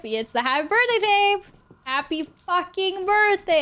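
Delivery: high, loud excited cheer. Real, not acted. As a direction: celebrate it loudly.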